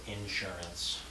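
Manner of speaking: insurance as IN-surance, as in Washington; first-syllable stress on insurance. Retold or imitated. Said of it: "not first syllable".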